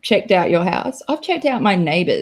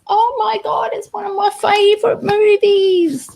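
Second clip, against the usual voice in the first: much higher, gushing; excited; 12 semitones higher than usual. Real, not acted. To chat about The Burbs, high excited gushing. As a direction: gush it up high.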